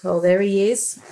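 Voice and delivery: ordinary delivery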